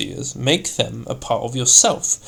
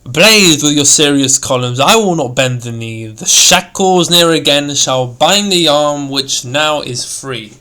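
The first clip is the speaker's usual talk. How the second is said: heavy, forceful, much louder command; thorough stress; 9 dB louder than usual. Shown as a demonstration. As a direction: bark it out in defiance with abrupt force.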